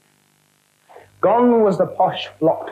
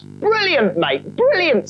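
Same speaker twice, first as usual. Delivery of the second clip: higher cockney delight; delighted spook; 9 semitones higher, slower than usual. Acted. Spook thanking Gordon, delighted cockney. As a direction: cheer the clever plan.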